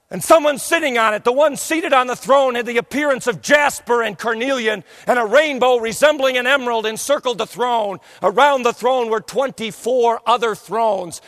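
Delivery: loud, fervent preaching, rising with awe; full-force preaching. Acted. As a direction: preach the vision with fire.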